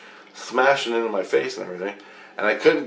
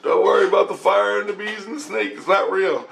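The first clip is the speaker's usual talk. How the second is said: Christ; 8 semitones higher than usual. Retold or imitated. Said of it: "and Christ said".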